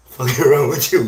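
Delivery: loud burst of laughter over the words; laughing. Real, not acted. Mocking women at the barbershop, bursts out laughing. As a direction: crack up while saying it. Cracks himself up mid-rant.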